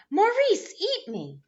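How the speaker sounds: high shout of a cookie. A narrator's reading. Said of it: "and it was shouting".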